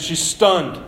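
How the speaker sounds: ordinary talk, nothing marked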